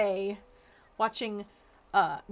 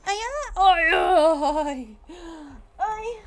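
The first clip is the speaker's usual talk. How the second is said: loud, high yell out the window; yelling at dogs; 7 semitones higher, 10 dB louder, much slower than usual. Real, not acted. Owner yelling for the dogs to come inside, loud. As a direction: shout for the dogs outside. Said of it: "I'm about to yell".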